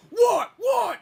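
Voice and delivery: high, loud challenge; mock an irate man spoiling for a fight; imitating angry driver. Imitates angry driver leaving car.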